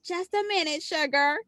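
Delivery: mock a syrup-thick Southern accent; syrupy Southern accent. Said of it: "has this syrup thick".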